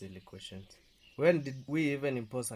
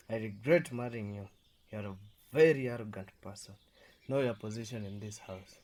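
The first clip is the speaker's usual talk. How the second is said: ordinary talk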